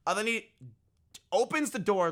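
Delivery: ordinary delivery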